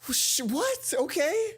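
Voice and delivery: high, loud, bewildered exclamations; stunned disbelief. Real, not acted. Player shocked as Shane forgives Hana, high loud disbelief. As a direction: reel in total disbelief. Gamer stunned by unexpected kindness.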